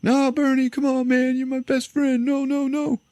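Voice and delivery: very high, wailing pretend grief; hammed-up tearful pleading; mock bawling. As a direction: imitate someone sobbing over a dead buddy. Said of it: "starts bawling, crying".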